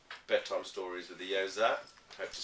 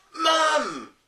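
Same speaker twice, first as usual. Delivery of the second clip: loud high call for Mum; child yelling for Mum; 14 dB louder than usual. Acted. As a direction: shout it across the house. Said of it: "yelled Kipper".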